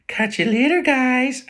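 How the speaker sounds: high, loud mock American accent; breezy American sign-off; American style. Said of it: "American style".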